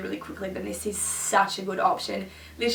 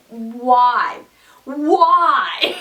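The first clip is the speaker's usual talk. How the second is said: mock despair; 6 dB louder than usual. Vlogger aghast at her lipstick count, mock despair. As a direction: wail it in playful exasperation.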